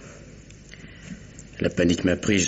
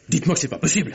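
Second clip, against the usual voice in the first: desperate plea; 10 semitones higher, faster than usual. Acted. Pleading in shock.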